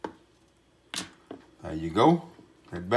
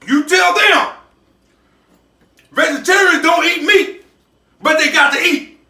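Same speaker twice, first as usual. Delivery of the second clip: loud and high, fired up about his cooking; rally the viewers, loud and proud; a cook sending a loud warning to the vegetarians' critics; 9 semitones higher, 6 dB louder than usual. Real, not acted. Cook ends the show fired up.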